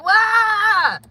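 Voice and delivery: high, loud, breaking cry of why; real tearful outcry. Real, not acted. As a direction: let the voice crack on why. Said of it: "it don't make sense to me".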